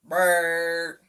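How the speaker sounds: loud buzzing brrr like a truck horn; horn blare. A narrator's reading. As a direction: buzz the lips like a horn. Horn sound in story.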